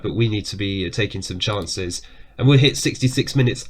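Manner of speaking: ordinary talk, nothing marked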